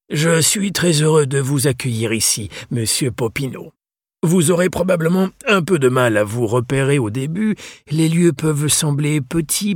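voix plus aiguë de vieux gentleman, affable; vieux libraire anglais accueillant. Acted. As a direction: accueillir avec chaleur et cérémonie. (le libraire accueille Mathias, aimable et cérémonieux)

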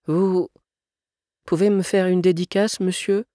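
put-on high-pitched shy little boy; hesitant, high child voice; timid child. A narrator's reading. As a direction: venture the request in a small, high child's voice. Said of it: "osa l'enfant en tendant son livre".

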